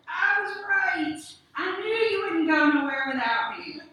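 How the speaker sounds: high, loud triumphant cry; excited shout. Acted. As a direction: crow with delight. She coughed and cackled.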